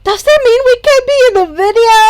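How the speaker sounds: excited. Acted. Mina eager to be filmed.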